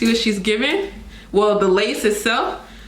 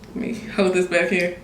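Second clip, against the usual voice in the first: normal talking voice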